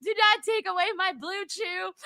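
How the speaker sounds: high-pitched, playful protest; mock scared plea. Streamer retelling fear of losing the sponsor, mock scared plea. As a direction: plead in hammed-up alarm. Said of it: "I was scared they were gonna take them away".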